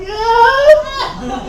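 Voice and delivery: very high weepy yeah; a pregnant ex appeased through tears; mock teary yes. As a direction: squeak out a tearful yes. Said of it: "She's like".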